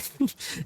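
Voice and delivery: high, airless giggle; breathy laugh. Real, not acted. Amused by the scene.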